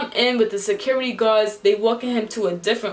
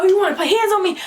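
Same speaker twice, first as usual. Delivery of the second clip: indignant challenge; 10 semitones higher, 4 dB louder, faster than usual. Retold or imitated. Retelling his angry challenge.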